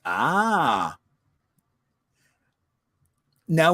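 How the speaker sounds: ordinary talk, nothing marked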